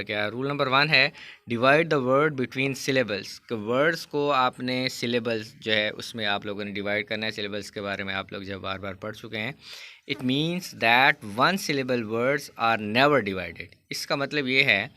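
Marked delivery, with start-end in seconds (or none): 1.5-3.3 reads the rule slowly, word by word; slow textbook reading. Shown as a demonstration. Teacher reading the rule aloud to students, slow and deliberate. 10.1-13.7 reads the rule slowly, word by word; slow textbook reading. Shown as a demonstration. Teacher reading the rule aloud to students, slow and deliberate.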